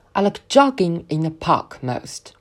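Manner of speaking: slow, "jogging" stretched and stressed. Shown as a demonstration. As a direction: draw out "jogging" at a slow pace. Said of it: "突出词…拉伸，并且重读". (慢速，重读拉长"jogging")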